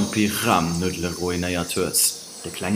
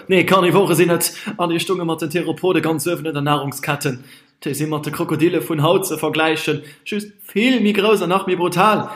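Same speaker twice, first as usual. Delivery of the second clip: excited know-it-all; 6 semitones higher than usual. Acted. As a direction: rattle off facts eagerly. Rehm lectures excitedly.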